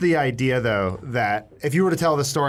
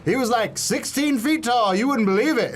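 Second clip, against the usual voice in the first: higher-voiced exaggerating bro telling a tall tale; bragging dude; 8 semitones higher, slower than usual. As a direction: hype it up like a show-off storyteller. Mocking a bragging storyteller.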